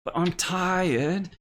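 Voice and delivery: put-on high, drawn-out child's complaint; whining young girl. Girl begs off the game with Cole, high tired whine. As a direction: plead slowly like a tired kid. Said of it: "And she's like".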